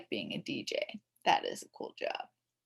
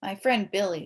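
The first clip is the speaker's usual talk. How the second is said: ordinary delivery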